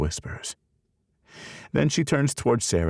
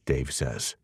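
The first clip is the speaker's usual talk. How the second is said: usual speaking voice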